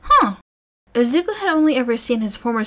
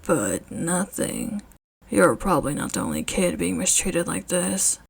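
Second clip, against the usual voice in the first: quiet steely resolve; low determined man voice; 3 semitones lower, 6 dB quieter than usual. Acted. Eraserhead, resolved.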